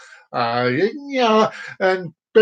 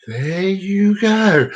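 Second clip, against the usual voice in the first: stretched-out, sing-song; slow drawn-out; much slower than usual. Shown as a demonstration. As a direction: drag every word out. Repeated catchphrase.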